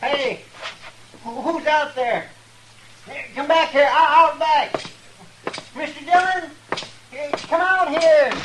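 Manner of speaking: high, urgent calls from the cell; calling out for help. Acted. Locked in a cell, calling for the marshal, high urgent shout. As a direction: yell to be let out, voice pitched high.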